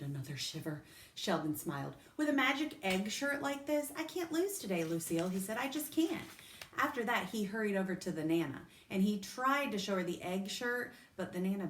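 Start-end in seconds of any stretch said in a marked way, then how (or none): 2.2-6.3 higher, confident boast; can't lose, pitched up; boastful. A narrator's reading. Sheldon bragging to Lucille about his shirt, excited.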